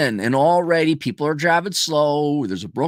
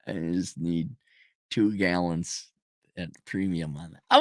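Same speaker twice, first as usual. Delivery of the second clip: quiet, wary request; 9 semitones lower, 8 dB quieter than usual. Retold or imitated. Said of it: "Can I pay for some gas?".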